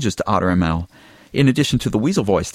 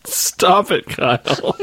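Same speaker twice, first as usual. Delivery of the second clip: laughing; 9 semitones higher, much slower than usual. Real, not acted. Laughing at Kyle.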